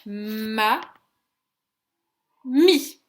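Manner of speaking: "Ma, mi" split with a long pause; syllable by syllable. Shown as a demonstration. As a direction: count out the syllables "Ma" and "mi" separately. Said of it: "d'abord on va compter les syllabes".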